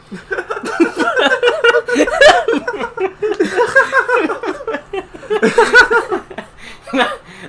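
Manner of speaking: high, loud, helpless laughter; long laughing fit. Real, not acted. Podcast host cracking up at his own joke, long loud laughter. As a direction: crack up and keep going.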